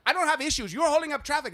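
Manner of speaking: high, loud defensive snap; mock shrill retort. Parking lady snapping at the man, high loud retort. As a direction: fire back at him. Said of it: "she was like".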